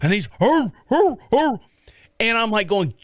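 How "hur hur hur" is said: high mock dog barks; short woofs imitating a big dog; imitated dog bark. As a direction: do the dog barking back. Imitates the neighbor's dog barking.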